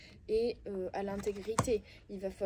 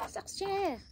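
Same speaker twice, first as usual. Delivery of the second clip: much higher mock voice on Sorcière; Sorcière pitched way up in a teasing imitation; imitating Chabouli; 11 semitones higher than usual. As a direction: squeak Sorcière like a playful witch call. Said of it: "comme elle dit, Chabouli".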